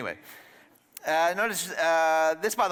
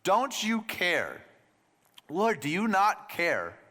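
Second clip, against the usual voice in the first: slow, weighed words; 5 semitones higher, much slower than usual. Shown as a demonstration. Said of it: "Sit with that phrase for a second".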